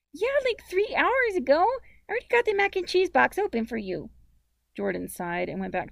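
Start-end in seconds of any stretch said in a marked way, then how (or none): 0.1-4.1 put-on very high, loud nine-year-old voice; high-pitched, bratty child; cross little sister. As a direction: talk back like an impatient little girl.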